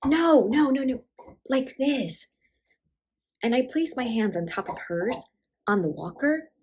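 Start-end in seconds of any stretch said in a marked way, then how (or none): 0.0-2.3 high, hurried-in correction; acted correcting. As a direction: step in to show her, voice raised in pitch. Daughter interrupts to show mother.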